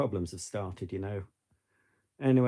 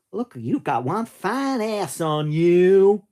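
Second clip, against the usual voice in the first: slow, much higher, louder American drawl; a hammed-up American voice, much higher and louder; mock American catcall; 8 semitones higher, 10 dB louder, slower than usual. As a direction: ham up a cheesy American pickup line.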